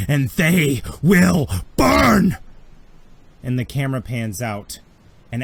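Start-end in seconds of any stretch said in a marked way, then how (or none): 0.1-2.4 slow, high, thundering threat; wrathful shout. Acted. Thrawn vowing revenge on the frontier, wrathful slow shout. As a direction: build to a roar on the last word. Villain declares war, climax of speech.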